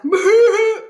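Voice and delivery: high, loud burst of laughter; loud guffaw. A narrator's reading. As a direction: laugh out big at the prank. Kids guffawing at prank.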